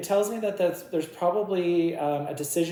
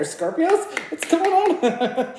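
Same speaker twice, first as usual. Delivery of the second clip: excited call-out; 13 semitones higher, 7 dB louder, slower than usual. Real, not acted. Calling out to Scorpios.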